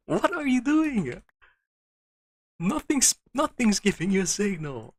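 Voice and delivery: very high, loud frustrated whine at the machine; exasperated squeal. Real, not acted. Scolding the stubborn machine, high-pitched and loud. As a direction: squeal it like the machine is betraying you.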